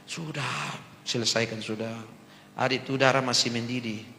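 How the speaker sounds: soft, calm counsel; fatherly advice. Retold or imitated. Old man quietly counsels the pastor, soft and calm. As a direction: gently advise a hot-headed youngster. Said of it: "Dia nasihat beta".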